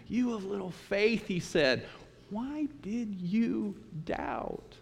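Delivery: soft, slow, tender reproach; Jesus softly chiding Peter after lifting him up; gentle rebuke. Acted. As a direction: hush it like a loving teacher.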